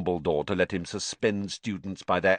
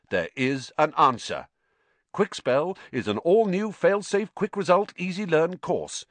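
higher, upbeat sales pitch; advertising read; 7 semitones higher than usual. A narrator's reading. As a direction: sell the course to doubtful customers. Reads an advertising leaflet.

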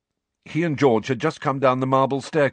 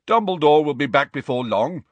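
higher, louder confident schoolboy; 7 semitones higher, 4 dB louder than usual. A narrator's reading. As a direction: say it with sure confidence. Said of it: "said Ernie Macmillan confidently".

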